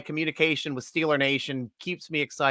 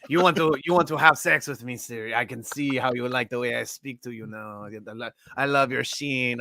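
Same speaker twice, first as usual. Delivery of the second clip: put-on French Canadian accent; 4 semitones lower, 4 dB quieter than usual. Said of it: "I think Siri likes your accent".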